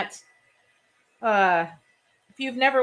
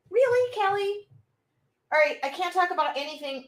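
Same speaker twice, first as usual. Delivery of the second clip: disbelief; 10 semitones higher than usual. Real, not acted. Disbelief at viewer.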